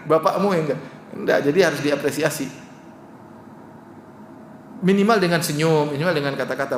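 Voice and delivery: usual speaking voice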